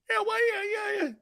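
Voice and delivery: high, squeaky whimper; mock crying. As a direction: whimper tearfully in a put-on falsetto. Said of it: "I'm crying over Robert Quinn".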